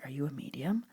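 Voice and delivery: imitating a hushed, whispery question; softly ask, as the curious newcomer. New girl's timid question, retold.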